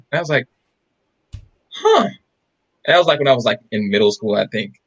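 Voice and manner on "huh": very high, drawn-in little huh; puzzled surprise. Retold or imitated. Child taking in mom's news about uncle, puzzled surprise. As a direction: let the news puzzle you, voice way up.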